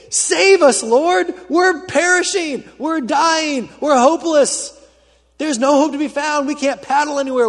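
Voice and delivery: loud, high-pitched desperate pleading; desperate disciples begging in terror; panicked cries. Acted. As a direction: cry out for rescue as if drowning, dropping on the last word. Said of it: "they wake him up in a panic".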